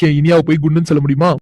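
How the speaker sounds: ordinary talk, nothing marked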